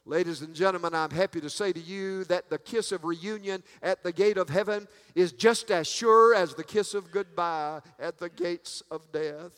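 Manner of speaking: ordinary talk, nothing marked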